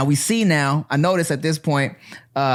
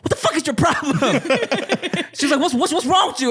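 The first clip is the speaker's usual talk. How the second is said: high, hammed-up outraged friend; mock outraged friend; faster than usual. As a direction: squeal it out like Rachel ready to fight. Friend Rachel about to fight.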